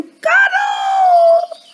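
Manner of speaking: very high, long-drawn "cuddle"; demonstrated cuddle. Shown as a demonstration. Storyteller shows children how to hug, high drawn-out cuddle. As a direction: squeal the word out in one long stretch.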